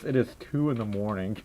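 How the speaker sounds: low, soft, offhand delivery; casual. Retold or imitated. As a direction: toss it off casually, quiet and low. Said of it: "it's so casual about it".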